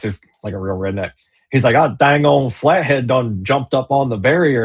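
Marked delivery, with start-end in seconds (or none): 1.8-4.6 lightly voiced country buddy, twangy and loose. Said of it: "he talks like this".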